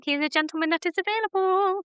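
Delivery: high, teasing sales pitch; playful matchmaker pitch. Pretend. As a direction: pitch him to the ladies. Selling her friend to viewers.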